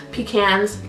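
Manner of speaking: "pecans" as pee-CANS; pecans with short a. Shown as a demonstration. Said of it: "Do you say pecans or pecans?".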